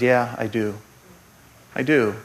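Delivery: low, soft, understated reply; quiet assurance. Acted. God answering people who say he doesn't understand, quiet and low. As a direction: answer gently, letting a pause sit before repeating.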